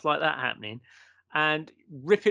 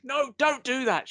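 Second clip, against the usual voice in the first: higher, slower panicky no; mock panicked plea; 8 semitones higher than usual. Medium's wife stops a man lifting the curtain, panicked. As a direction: imitate the wife's alarmed cry to stop him. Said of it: "she said in panic".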